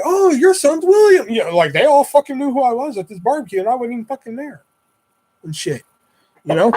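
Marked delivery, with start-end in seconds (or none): 0.0-1.3 high, loud, drawn-out mock excitement; gushing recognition. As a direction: imitate neighbours gushing as it dawns on them. Neighbours realising who son is.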